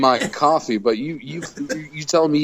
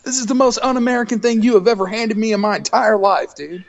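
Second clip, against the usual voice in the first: higher, indignant mock rant; mock outrage; 8 semitones higher than usual. Retelling a McDonald's trip, in higher mock outrage. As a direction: act out the disbelief at the tea.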